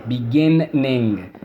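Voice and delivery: "Beginning" slowed, be-GIN-ning with doubled n audible; accent on second syllable. Shown as a demonstration. Teacher shows the doubled consonant, slow and stressed. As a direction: stretch "Beginning", leaning on GIN before a separate ning. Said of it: "accent is on the second syllable".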